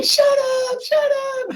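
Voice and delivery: high-pitched mock screaming; high, strained retold shriek; imitated panicked yelling. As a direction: yell it like panicked kids in a car. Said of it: "And they're just yelling".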